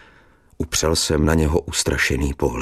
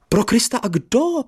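put-on cheerful old teacher. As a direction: chatter fondly like a relieved old teacher. Teacher alarmed.

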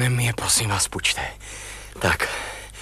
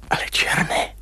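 whispered, barely voiced; a stunned whisper to himself; hushed whisper; 3 dB quieter, faster than usual. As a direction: breathe it out in awe. Startled at his reflection.

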